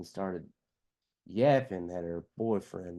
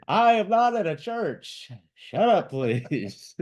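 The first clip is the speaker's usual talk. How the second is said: high, loud, drawn-out protest; exasperated crowd shout; imitated heckler yelling; 10 semitones higher, 7 dB louder, slower than usual. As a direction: holler a fed-up complaint, easing off on "please". Acting out crowd member heckling lecture.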